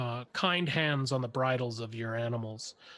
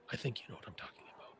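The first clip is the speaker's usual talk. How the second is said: fast, menacing whisper; threatening whisper; 4 semitones lower, 14 dB quieter, much faster than usual. Acted. Militia leader to Blaine, a fast whispered threat. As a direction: hiss it as a parting threat.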